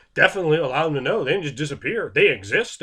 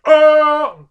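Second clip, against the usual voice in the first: sudden shocked yelp, much higher and louder; startled oh; 14 semitones higher, 14 dB louder than usual. Retold or imitated. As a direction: caught off guard, blurt it out. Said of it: "don't be like".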